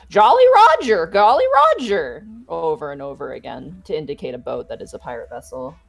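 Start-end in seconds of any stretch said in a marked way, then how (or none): 0.1-2.3 higher, louder parrot squawk. Acted. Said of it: "Davy go down ... and go".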